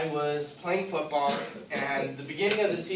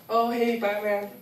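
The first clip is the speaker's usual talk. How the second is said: higher-pitched, breezy mock hello; all casual; 6 semitones higher than usual. Kid on stage greeting imagined Batman, casual. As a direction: greet a superhero like an old pal. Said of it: "I would be all casual".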